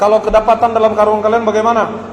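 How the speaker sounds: high, loud protest of innocence. Acted. Accused brothers answering the guard, high loud protest.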